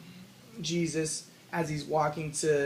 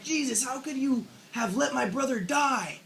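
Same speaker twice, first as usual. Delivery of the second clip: higher-pitched upset plea, no sobbing; Мэри tearful reproach; 7 semitones higher than usual. Retold or imitated. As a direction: imitate an upset, reproachful Mary. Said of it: "Mary is crying and she says".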